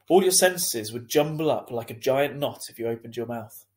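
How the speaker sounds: plain reading voice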